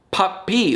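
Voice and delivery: "Puppy" split, second syllable stretched out; "Puppy" with a held, punched last syllable; stress on final syllable. Shown as a demonstration. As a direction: break "Puppy" in two and lean on the end. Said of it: "the final syllable of this one".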